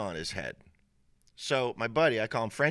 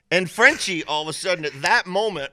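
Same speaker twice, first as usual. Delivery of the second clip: loud, high, laughing storytelling; 9 semitones higher, 10 dB louder than usual. Real, not acted. Teller reaching the punchline about a buddy, laughing. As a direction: carry on telling through laughter.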